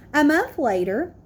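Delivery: usual speaking voice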